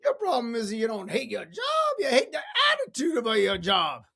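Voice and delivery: high put-on accent, building to a loud punchline; bad impression that swells into a shout; lousy Zig Ziglar. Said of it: "That was a lousy impersonation of Zig Ziglar".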